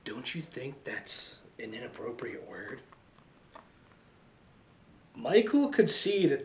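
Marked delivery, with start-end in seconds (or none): 0.1-2.8 much softer, lower gentle question; dad's quiet aside. Acted. Dad asks mom softly about the word. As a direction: murmur it to your wife. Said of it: "said his dad".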